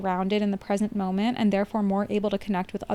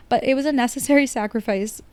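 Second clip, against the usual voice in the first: ordinary talk, nothing marked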